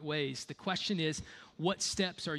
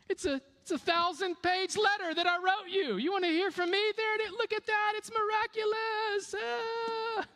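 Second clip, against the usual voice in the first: mock God voice; 13 semitones higher than usual. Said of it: "if I were God, this is what I would do".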